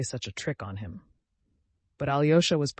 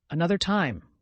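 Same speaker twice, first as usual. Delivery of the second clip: ordinary delivery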